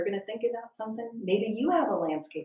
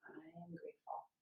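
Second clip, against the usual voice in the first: whisper; 4 semitones lower, 17 dB quieter than usual. Shown as a demonstration. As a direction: breathe the mantra out, barely voiced. Meditation guidance whispered.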